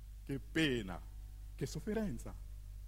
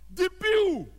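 high, loud cry for more; exultant shout; 11 semitones higher, 5 dB louder than usual. Acted. Boasting narrator demanding more than all Italy, exultant shout. Outbidding, acted crescendo.

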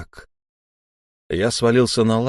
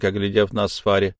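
plain reading voice

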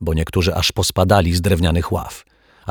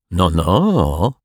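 drawn, offended tut; injured innocence. A narrator's reading. Rogue poses as wronged, offended innocence. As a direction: chide them as if wrongly accused. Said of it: "przybrał pozę urażonej niewinności".

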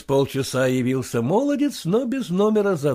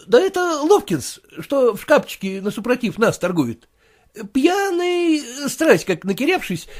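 much higher, fussy clerk's voice; clerk reporting; 9 semitones higher, 4 dB louder than usual. Acted. The clerk tells his master about the drunk neighbour, fussy.

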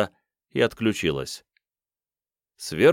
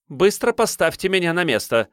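commanding; 9 semitones higher than usual. Acted. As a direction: order him to put her down. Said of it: "распорядилась она".

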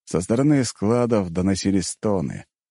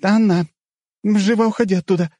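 very high, panicked female squeal; high, urgent squealed command; 4 dB louder than usual. A narrator's reading. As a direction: shriek the warning at a very high pitch. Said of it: "взвизгнула Злата".

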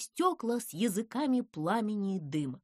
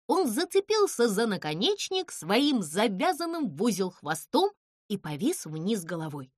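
ordinary delivery, nothing marked

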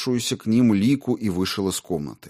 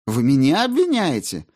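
indignant; 6 semitones higher, 4 dB louder than usual. A narrator's reading. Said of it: "возмутилась Маргарита".

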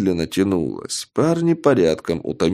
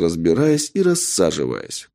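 usual speaking voice

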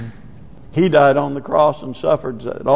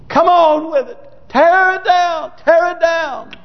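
high, impassioned cry; urgent plea; 12 semitones higher than usual. Acted. Saints urging the Lord to bring the kingdom, urgent cry. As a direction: call on God with rising urgency.

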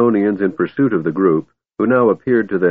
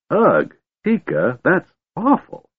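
slow, choked, higher gasping; barely able to speak; 10 semitones higher, slower than usual. A narrator's reading. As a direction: force it out while still gagging. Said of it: "He could barely speak".

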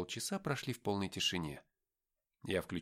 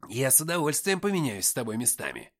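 exclaiming; 3 semitones higher, 6 dB louder than usual. Acted. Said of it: "воскликнул Никита".

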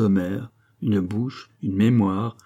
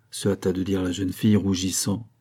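ordinary delivery, nothing marked

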